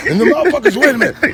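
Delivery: usual speaking voice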